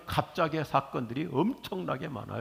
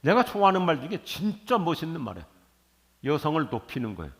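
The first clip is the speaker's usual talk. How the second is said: ordinary talk, nothing marked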